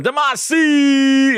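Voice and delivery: high, loud, stretched-out name; long excited hail; calling out. Real, not acted. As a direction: holler the name in greeting. Calling out to arriving viewer.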